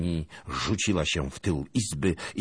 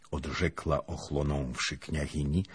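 ordinary delivery

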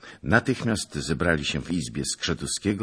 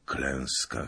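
Klęska said low and falling, much softer; grim confirmation; 4 semitones lower, 6 dB quieter than usual. Acted. Messenger confirms the rout, quiet and grim. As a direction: let Klęska sink, quiet and heavy.

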